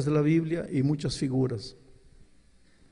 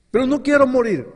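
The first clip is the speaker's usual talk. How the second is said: impassioned plea; 11 semitones higher, 9 dB louder than usual. Retold or imitated. Sinner pleads to God.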